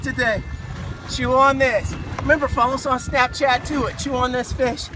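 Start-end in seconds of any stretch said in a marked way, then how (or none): none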